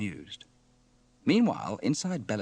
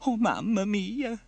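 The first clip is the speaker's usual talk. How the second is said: high, slow Italian lament; loud, drawn-out theatrical despair; Bella crying out; 11 semitones higher, 6 dB louder, slower than usual. Acted. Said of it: "cried Bella".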